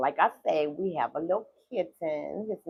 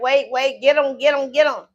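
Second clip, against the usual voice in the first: louder, alarmed cry to grab the cat; retold panic; 5 semitones higher, 10 dB louder than usual. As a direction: call out in a mock panic for someone to get him. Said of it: "I was like".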